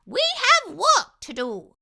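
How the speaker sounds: high, sharp and loud; sharp mouse voice. Acted. Dentist scolding the fox, sharp high voice. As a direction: snap it in a squeaky voice. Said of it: "said the dentist sharply".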